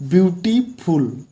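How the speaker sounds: ब्यूटीफुल said slowly, split into beauty and ful; teacher sounding out ब्यूटीफुल syllable by syllable. Shown as a demonstration. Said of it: "अब यहाँ तक का एक syllable हो गया".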